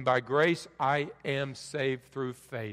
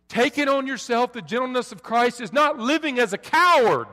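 loud, raised preaching voice; fiery preaching; 7 semitones higher, 7 dB louder than usual. Acted. Pastor rebuking the congregation about anger, loud fiery preaching. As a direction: drive it home like a pulpit rebuke.

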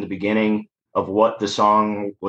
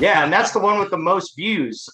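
imitating a girl; 9 semitones higher than usual. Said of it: "several girls come up and be like".

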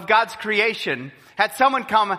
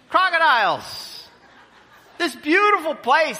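astonished exclamation; 9 semitones higher than usual. Real, not acted. Preacher exclaims at crocodiles.

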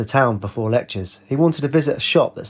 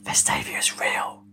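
slow, soft whisper; 7 dB quieter, slower than usual. A narrator's reading. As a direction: breathe it like a secret. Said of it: "She whispered".

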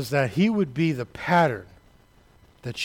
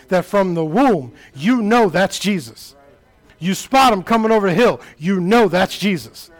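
impassioned preaching, building from raised voice to high shouting; full-force preaching; 9 semitones higher, 9 dB louder than usual. Real, not acted. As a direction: preach it louder and higher, driving every phrase to a shout.

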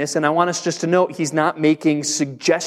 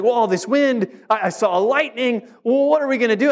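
put-on higher, worried little girl; anxious daughter; 6 semitones higher than usual. Pastor mimicking his daughter frightened by the storm, higher and anxious. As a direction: mimic a scared child asking questions. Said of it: "She was like".

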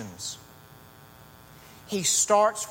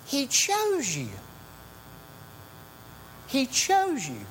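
slow, high stress on "chose"; emphatic; 9 semitones higher, 3 dB quieter, slower than usual. Acted. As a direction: lean hard on "chose" in "He chose you". Preacher stressing key phrase.

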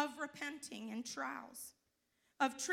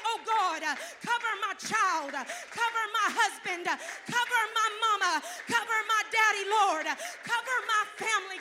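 loud, high, pleading cry to God; fervent crying prayer; 7 semitones higher, 16 dB louder than usual. Acted. Mother praying for her family in the closet, loud and pleading. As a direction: beg God aloud with everything you have.

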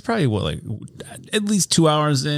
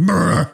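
loud lip trill; 5 semitones higher, 5 dB louder than usual. Retold or imitated. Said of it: "we're just like".

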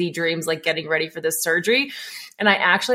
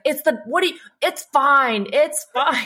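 higher, insistent mock yell; 7 semitones higher than usual. Mary brushes off the patient in a dream, mock yelling. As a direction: imitate someone yelling reassurance at you. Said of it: "yelling at me saying".

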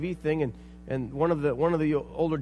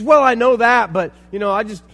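indignant retort; 5 semitones higher, 12 dB louder than usual. Retold or imitated. Offended man voiced in story.

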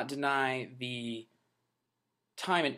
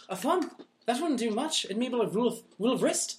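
high, mocking Irish accent; 8 semitones higher than usual. Norman Reedus answering the lady, high Irish accent. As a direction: toss it off in a cheeky Irish accent. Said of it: "they have, like, Irish accents".

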